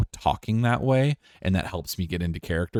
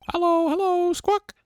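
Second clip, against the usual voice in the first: very high, piercing parrot squawk. Acted. Pet parrot greeting visitors, very high squawky voice. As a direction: squeak it like a talking bird.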